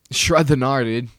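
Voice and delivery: snowboarder dude. Acted. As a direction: belt it like a stoked snowboarder. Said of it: "They seem like they do a lot of drugs, snowboarders".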